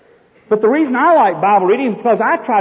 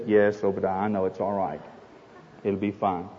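calm Jesus; 11 semitones lower, 10 dB quieter than usual. Acted. Jesus reassures the owner.

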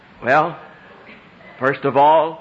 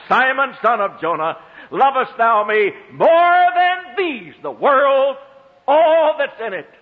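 impassioned preaching; 7 semitones higher than usual. Acted. Preacher pleading as the Lord to Simon, rising to full force. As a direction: lift the plea over the whole world.

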